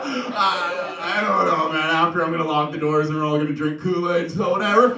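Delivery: high, loud hammed-up rant; over-the-top shouted reply; mock ranting. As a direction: yell it out like a comic madman. Loud acted reply to alarmed listener.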